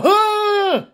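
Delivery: loud, high-pitched mock cry of horror; shocked gasp-shout. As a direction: let out a sudden high yelp. Acts out shocked reaction, "I go.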